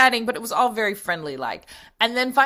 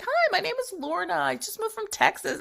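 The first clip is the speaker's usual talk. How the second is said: nervous chatter; 13 semitones higher, 3 dB quieter than usual. Retold or imitated. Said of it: "And I'm like".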